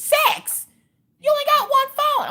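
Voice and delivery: high, loud shriek of disbelief; shocked disbelief. Retold or imitated. Mom shrieking at her daughter over six tripods, high and loud.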